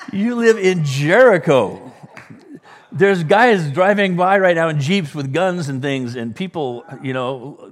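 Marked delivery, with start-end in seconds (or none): 0.1-6.2 loud, high-pitched exasperated disbelief; mock incredulous. Preacher to Jericho restaurant owner who found Detroit dangerous, mock incredulous. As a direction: blurt it out in comic astonishment, much louder.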